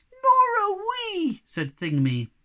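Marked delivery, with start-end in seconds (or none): 0.1-1.4 much higher, louder tiny defiant voice; squeaky little creature. Acted. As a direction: pipe up like a tiny brave creature.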